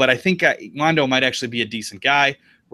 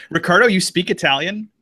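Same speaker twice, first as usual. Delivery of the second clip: ordinary delivery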